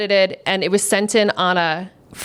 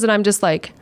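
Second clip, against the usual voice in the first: ordinary talk, nothing marked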